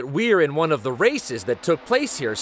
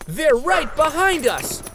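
high, thrilled cry; breathless excitement of being chased; excited; 12 semitones higher, 3 dB louder than usual. Acted. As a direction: squeal it as the dog catches up. Dogs chasing the motorcycle.

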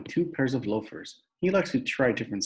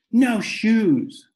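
put-on loud, high childish yell; toddler yell; 12 semitones higher, 8 dB louder than usual. A narrator's reading. Little brother refusing new shoes, high yell.

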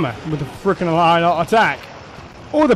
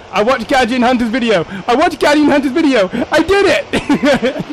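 gleeful boast; 7 semitones higher, 5 dB louder than usual. Real, not acted. Gleeful boast on beating a trick.

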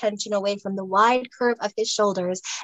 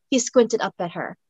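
ordinary delivery, nothing marked

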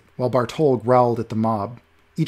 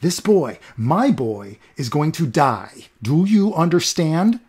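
louder, slow, furious; furious threat; 4 semitones higher, 5 dB louder, slower than usual. Acted. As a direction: hammer each word home to scare him. Olaf threatening the doorman.

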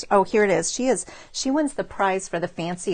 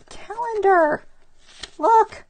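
much higher, louder, thrilled at the surprise calendar; delighted reveal; 13 semitones higher, 7 dB louder, slower than usual. Real, not acted. Showing viewers a surprise calendar card, excited and high. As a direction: squeal it out like you just found a hidden treat.

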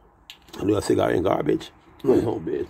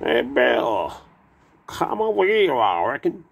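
cowboy drawl; 5 semitones higher, slower than usual. Retold or imitated. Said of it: "'Cause they cowboys".

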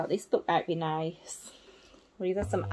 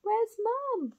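high little worried voice; small worried owl; 14 semitones higher than usual. Acted. Baby owls wonder where mum is, high. Owlets wait for mother.

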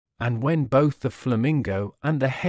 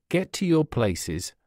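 usual speaking voice

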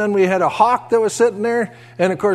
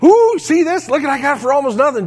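high, loud, fast thrilled show-off; excited wife; 7 semitones higher, 4 dB louder than usual. Retold or imitated. Wife shows husband her cheap perfect outfit, thrilled. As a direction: gush over a bargain outfit. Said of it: "shows Jeff".

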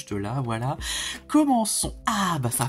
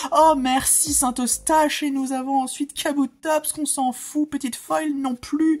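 elated; 8 semitones higher, 4 dB louder than usual. Real, not acted. Still elated after the pull.

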